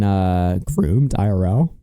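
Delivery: ordinary delivery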